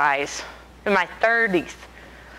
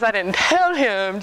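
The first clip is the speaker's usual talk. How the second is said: ordinary talk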